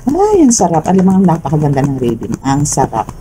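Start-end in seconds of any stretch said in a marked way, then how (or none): 0.0-0.8 delighted. Real, not acted. Delighted by the reading.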